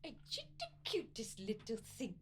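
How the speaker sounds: put-on soft, playful baby talk; girl cooing at chickens. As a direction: coo softly as if to a pet. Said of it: "so she's kinda like".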